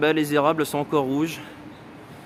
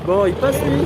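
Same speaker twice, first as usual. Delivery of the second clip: higher, louder mock of the staring locals; playful imitation of annoyed onlookers; 8 semitones higher, 4 dB louder than usual. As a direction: lightly voice the gawking crowd as he walks past. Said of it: "la gueule qu'ils avaient tous, tu sais, genre".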